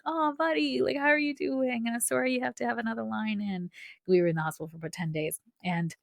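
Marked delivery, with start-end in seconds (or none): 0.0-3.7 nurse voiced gently. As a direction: gently imitate the kind young nurse greeting a child.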